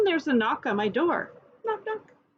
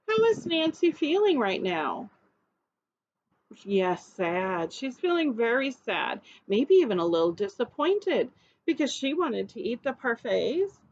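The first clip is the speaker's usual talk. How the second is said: ordinary talk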